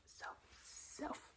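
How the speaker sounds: soft, unvoiced repeated whisper; whispered trigger word. Shown as a demonstration. ASMR host repeating a word to viewers, whispered.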